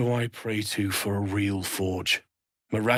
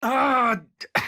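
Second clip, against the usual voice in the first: loud, high cry cut short; alarmed outburst; 14 semitones higher, 6 dB louder than usual. Acted. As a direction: burst out loudly, pitched way up.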